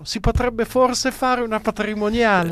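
high, tentative little voice; mock hushed timidity. Presenter imitating politicians timidly floating a wealth tax, mock hushed. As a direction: float it gingerly, pitched well up, as if barely daring. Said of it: "anche un po' a bassa voce".

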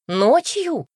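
sharp rising astonishment; astonished. A narrator's reading. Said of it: "изумилась Бет".

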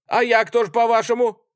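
high, loud demand; indignant challenge. A narrator's reading. Artskhanov challenges the soldiers, indignant. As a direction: throw the question at them, outraged.

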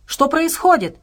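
high, alarmed demand; roaring. A narrator's reading. Bear cub demands an explanation, roaring. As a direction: raise the pitch sharply in alarm. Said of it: "взревел медвежонок".

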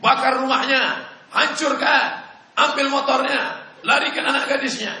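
loud, high mock villain shouting orders; menirukan teriakan penjahat. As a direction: yell it like a gang boss egging men on, half laughing. Penjahat berteriak menyerang. (teriakan penjahat yang dibuat-buat sambil tertawa)

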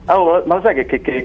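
ordinary delivery

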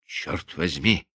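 low, slow muttered curse; muttering. A narrator's reading. Husband steps back from the silent door, muttering. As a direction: mutter it to yourself, softly. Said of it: "пробормотал он".

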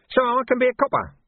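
a wheedling gutter lad taking offence; cheeky street urchin Nobby, aggrieved. Acted. Nobby's voice held.

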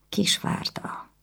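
whispered slowly under her breath; murmured. Acted. Anna, at the window, whispering the hated name. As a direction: breathe the name out, barely there.

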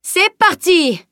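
slow, high, loud cheer; a knight cheering on the fight, slow loud shout. Acted. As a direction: drag out a big excited shout. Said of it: "cria Bjorn".

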